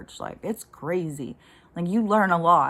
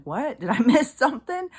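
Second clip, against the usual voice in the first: laughing disbelief; 7 semitones higher, 5 dB louder than usual. Retold or imitated. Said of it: "I'm like".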